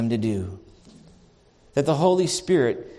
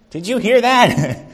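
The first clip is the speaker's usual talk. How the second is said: mock nudging wife; 8 semitones higher, 7 dB louder than usual. Wife nudging her hard-of-hearing husband.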